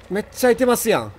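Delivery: ordinary delivery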